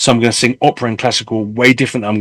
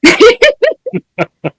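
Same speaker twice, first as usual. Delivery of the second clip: sudden high laugh; 4 dB louder than usual. Real, not acted. Laughing at own advice.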